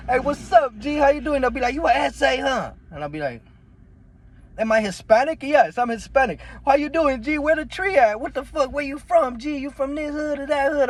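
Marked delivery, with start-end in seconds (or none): 0.1-1.4 high, loud street greeting; mimicking the homies. Kids greeting him, acted. 1.7-2.7 high, questioning homie voice; mimicking the homies. Kids questioning him. 4.6-6.4 higher, louder mocking voice; mock exchange. Mock dialogue about ethnicity. 6.6-10.9 mimicking the homies. Kids interrogating him, acted.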